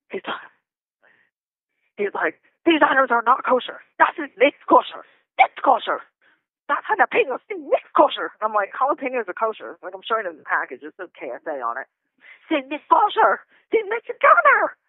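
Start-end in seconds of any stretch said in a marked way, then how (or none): 2.6-8.3 mock German officer's rant, pitched up; indignant pseudo-German scolding, hammed up; imitated German yelling. Detainee imitates the officer ranting about kosher food, mock German yelling. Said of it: "might as well be yelling in German". 12.5-14.8 high-pitched mock German outburst; imitated German yelling. Detainee mimics the officer rejecting jalapenos, high mock German yelling. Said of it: "might as well be yelling in German".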